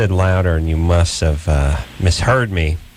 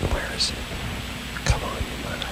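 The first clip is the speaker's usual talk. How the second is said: hushed whisper; 12 dB quieter than usual. Real, not acted. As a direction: whisper while listening for a sound. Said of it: "Let's be quiet and see".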